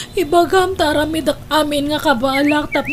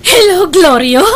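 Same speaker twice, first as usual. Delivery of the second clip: louder, higher, crying hope; mother crying as she greets her son; tearful hello; 4 semitones higher, 7 dB louder than usual. Acted. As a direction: answer the call through tears.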